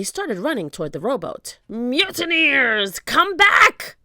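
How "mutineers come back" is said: slow, then high and loud yell; captain roaring after his men; shouted call. A narrator's reading. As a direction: call the deserters back. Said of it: "shouted Captain Bones".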